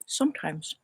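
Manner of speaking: a soft Irish whisper. Acted. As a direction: breathe the word in an Irish whisper. Whispered word in Irish bit.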